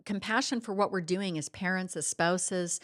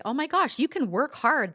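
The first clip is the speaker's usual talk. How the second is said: surprised realization; 6 semitones higher, 4 dB louder than usual. Retold or imitated. Sudden realization, retold.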